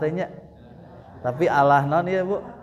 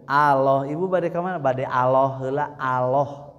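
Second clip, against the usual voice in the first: normal talking voice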